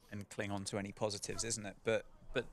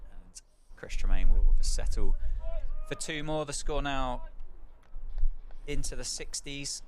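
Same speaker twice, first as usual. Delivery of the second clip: louder and louder commentary that builds to the wicket call; live play-by-play; 2 semitones higher, 9 dB louder than usual. Acted. Commentator calling the final wicket for listeners, excited play-by-play. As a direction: call the last wicket as it happens, rising to the big moment.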